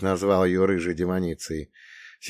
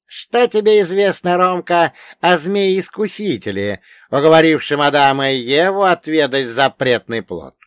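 high, loud elder quizzing a pupil; raised, emphatic voice of a learned old man; old storyteller pitched high and forceful; wise old dead man; 7 semitones higher, 7 dB louder than usual. Acted.

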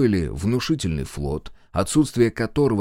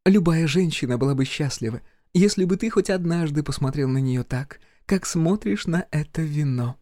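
higher playful woman's voice; sly teasing female voice; Viv's voice; 7 semitones higher than usual. Acted.

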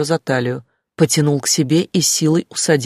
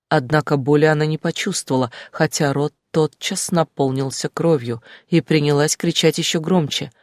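usual speaking voice

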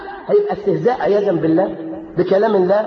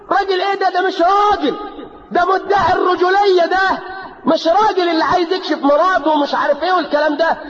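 fiery preaching; 8 semitones higher than usual. Real, not acted. Preacher denouncing husbands who unveil their wives, shouting. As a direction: rail against the so-called man.

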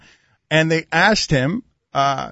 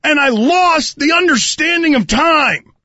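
excited; 9 semitones higher than usual. Pretend. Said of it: "walking up to people".

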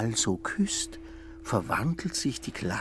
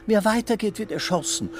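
high, loud cheeky nymph; playful call; 13 semitones higher, 6 dB louder than usual. A narrator's reading. Paneuropa cheekily calls a warning to Schmitz, high and loud. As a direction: toss it out teasing, mock-threatening. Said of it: "ruft sie ihm übermütig zu".

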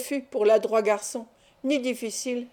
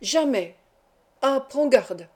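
raised, heated exclamations; heated quarrel; 2 semitones higher, slower than usual. A narrator's reading. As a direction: fire back in a tense quarrel. Heated quarrel, refusal then threat. (exclamations de dispute)

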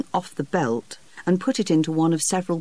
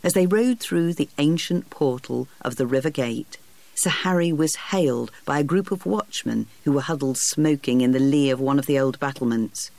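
usual speaking voice

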